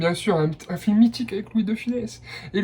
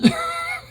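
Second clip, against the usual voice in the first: short high laugh; 4 dB quieter than usual. Real, not acted. Laughs at the Koh-Lanta clip.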